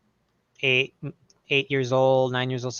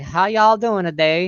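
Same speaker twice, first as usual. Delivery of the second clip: slow, higher-pitched Southern greeting; Southern accent; 5 semitones higher than usual. Retold or imitated. Imitating people met in Tennessee, Southern accent. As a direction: stretch it into a friendly Southern drawl. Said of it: "having people talking, like".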